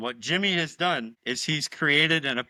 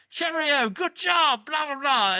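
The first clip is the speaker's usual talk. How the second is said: hammed-up posh British accent, much higher; mock British; 13 semitones higher, 4 dB louder, slower than usual. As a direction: mimic the silly British chant, pitched way up. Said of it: "sounds utterly ridiculous in British".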